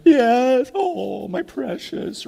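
high, whispery-odd possessive croon; Gollum-like. Acted. Owner clutching his beloved gift, possessive creature croon. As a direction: cradle it like a creature hoarding treasure.

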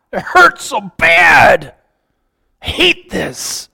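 high, slow, pained lament; acted pain. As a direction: stretch it out as if in pain. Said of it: "like that woman in labor".

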